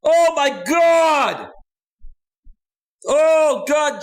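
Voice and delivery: loud, high excited whoa over a big donation; excited shout. Real, not acted. Streamer shouting at a viewer over her donation, stunned and thrilled. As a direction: yell out the shock of a big tip.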